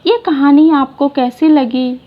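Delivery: plain reading voice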